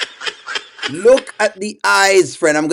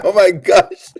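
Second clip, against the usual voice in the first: ordinary talk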